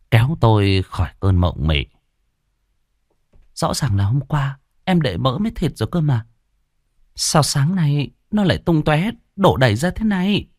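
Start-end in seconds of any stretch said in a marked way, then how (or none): 3.5-10.5 lightly voiced mother, higher and puzzled; a hint of a mother wondering aloud. A narrator's reading. Mother asks father about spilled lard, lightly puzzled. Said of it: "tiếng của mẹ ở ngoài sân vang lên".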